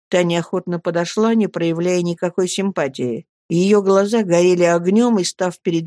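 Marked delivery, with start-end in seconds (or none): none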